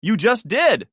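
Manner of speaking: high, loud yell. Acted. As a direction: yell it back at him. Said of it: "she yelled".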